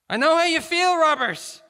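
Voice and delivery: loud pleading. A narrator's reading. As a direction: plead with him across the distance. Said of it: "Garrison pleaded".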